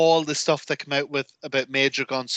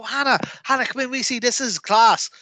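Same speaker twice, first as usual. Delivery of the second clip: excited calling out; 9 semitones higher than usual. Retold or imitated. Fan calling girlfriend in to watch, excited calling. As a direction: call someone in eagerly, mock shout. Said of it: "I'd shout into my girlfriend".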